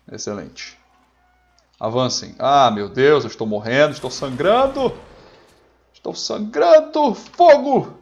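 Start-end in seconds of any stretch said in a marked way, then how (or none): 2.4-7.1 melodramatic panic. Pretend. Gamer playing a soldier who is bleeding out, building melodramatic panic. Mock dying soldier in the game. 7.1-8.0 high, very loud battlefield order; shouted command. Pretend. Officer ordering his soldiers to fire, high shouted command.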